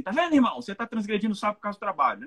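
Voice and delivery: hasty, high-pitched telling-off; accusing rebuke. Acted. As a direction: pounce on a brother's fault, rattling it off. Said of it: "É muito fácil chegar e falar assim".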